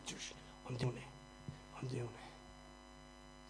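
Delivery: breathless whisper, I'm doing it; whispered persistence. Pretend. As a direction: whisper it stubbornly to yourself. Believer keeps going under insults.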